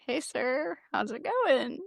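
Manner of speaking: small, high, soft voice; sheepish greeting. Retold or imitated. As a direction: imitate an awkward hello to the boss. Nervously greeting her commander, retold.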